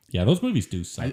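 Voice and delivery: ordinary talk, nothing marked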